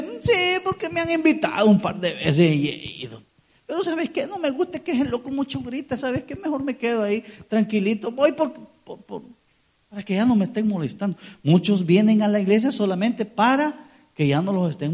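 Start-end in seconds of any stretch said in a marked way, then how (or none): 0.0-11.2 lightly voiced, softer, grumbling excuse; reluctant churchgoer. Preacher mimicking a churchgoer who dislikes the shouting, softer grumbling. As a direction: mumble it like someone dodging church.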